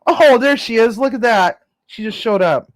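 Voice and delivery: loud, high, delighted hello; excited greeting. Real, not acted. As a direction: greet her with big excitement. Greeting someone who walks in.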